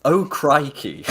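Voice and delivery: high, drawn-out mock exclamation; imitated orc dialogue. Quoting co-host's improvised orcs, amused mock dismay. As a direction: stretch it out, tickled by the silliness. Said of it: "your improv dialogue for what the orcs might say".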